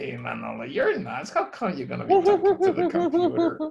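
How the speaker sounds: high, fast, incredulous self-mockery; mocking inner voice. To himself, doubting talking to a computer: high mocking voice. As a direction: scoff at your own crazy idea in a squeaky voice. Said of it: "And I say".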